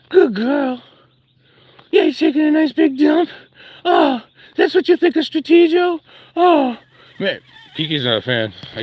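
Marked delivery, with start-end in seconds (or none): 0.1-0.8 dog praise. Real, not acted. Praising the dog Kiki, in a high pet voice. 1.9-6.8 high mock-hurt pet talk. Real, not acted. Asking the dog Kiki if she dislikes Strategio, high pet voice.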